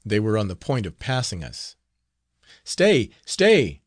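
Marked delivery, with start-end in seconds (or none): 2.6-3.8 high, loud desperate shriek. A narrator's reading. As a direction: shriek to stop the riders. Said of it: "shrieked Marguerite".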